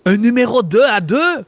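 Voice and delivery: put-on loud, high query in a German accent. Guard questioning the impostor by radio, loud German accent. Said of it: "d'un accent allemand".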